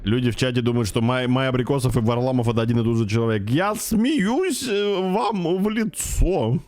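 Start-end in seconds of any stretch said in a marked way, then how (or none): 3.5-6.6 mocking. Streamer mocks chat theory.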